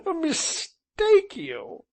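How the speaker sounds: high, very slow gasping laugh; gasped laughter. Acted. Gentleman gasping with laughter on the settle. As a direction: gasp it out laughing. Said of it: "he gasped".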